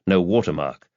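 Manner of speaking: plain reading voice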